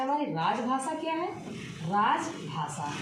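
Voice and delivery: normal talking voice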